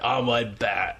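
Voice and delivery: lightly voiced British wrestler; a hint of Wade Barrett's voice; Wade Barrett impression. Co-host naming the botched accent, light impression. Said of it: "that was kind of Wade Barrett".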